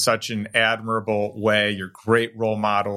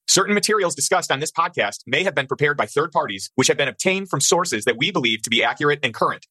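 rapid, slightly higher legal read; fast legal disclaimer; 4 semitones higher, faster than usual. Acted. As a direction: rattle through the fine print.

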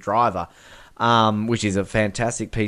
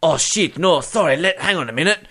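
hammed-up flustered henchman, pitched up; higher-pitched mock panic; Stamper; 5 semitones higher than usual. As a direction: play a henchman scrambling on the phone. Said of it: "like, Stamper going".

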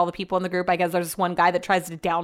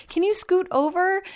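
much higher pitched whiny ask; high mock plea; 10 semitones higher than usual. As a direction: squeak it out like a put-upon girlfriend. Asking boyfriend for space in bed.

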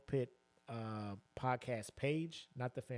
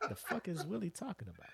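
normal talking voice

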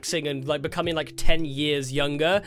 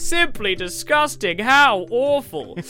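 louder, slow mimicked disgust; Kenku echo; 5 semitones higher, 6 dB louder, slower than usual. Kenku echoing the orcs, mimicking their horror. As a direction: parrot the appalled line back.

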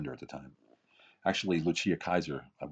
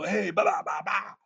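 high, loud mock yelling of filler sounds; imitating stage screaming; 14 semitones higher, 3 dB louder than usual. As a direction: ham it up like a shouting hype man. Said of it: "they're screaming on stage".

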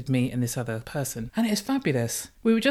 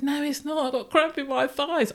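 pained whine; 11 semitones higher than usual. Retold or imitated. In pain from cramp.

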